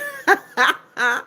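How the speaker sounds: a quick high burst of laughter; short laugh. Real, not acted. Streamer laughing at a cheeky chat comment, short high laugh. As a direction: laugh at the message.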